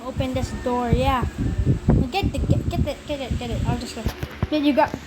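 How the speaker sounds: ordinary talk, nothing marked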